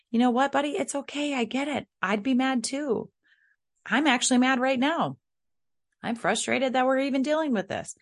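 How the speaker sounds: put-on high-pitched parent leveling with a child; empathizing frustrated parent. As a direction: keep it high and emphatic, mock-exasperated but kind.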